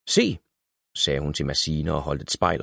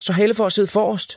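put-on eager child; 14 semitones higher, 4 dB louder than usual. A narrator's reading. Esther calls dibs.

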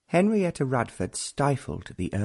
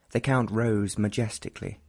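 ordinary delivery

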